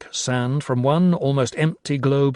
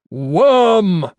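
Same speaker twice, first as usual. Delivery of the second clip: loud, high, drawn-out cry; 7 semitones higher, 8 dB louder than usual. A narrator's reading. Carstairs cries out as the others fall silent. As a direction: call up a monster with one word. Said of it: "Carstairs cried".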